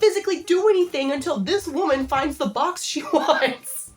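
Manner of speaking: high, loud exasperated wail; exasperated outburst. Acted. Shopper to employees who keep announcing registers, exasperated. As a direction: burst out in mock despair, easing down towards a laugh.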